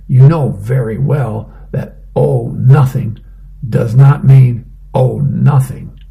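loud, pointed scolding. Acted. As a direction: tell him off, pointedly. Wife scolding husband.

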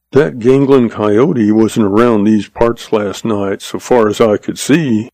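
ordinary delivery, nothing marked

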